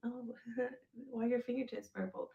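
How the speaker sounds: put-on a soft, giggly, girlish take on the question; sweet feminine act. To the man gripping her, a soft smiling feminine act. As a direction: play it sweet and smiling to disarm him. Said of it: "once I became feminine and started smiling".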